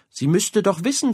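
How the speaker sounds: ordinary delivery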